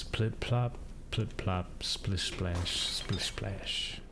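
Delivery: hushed plip plop, whispered splish splash; dripping water sounds. Retold or imitated. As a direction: softly echo the dripping chant. Echoing the game's dripping chant.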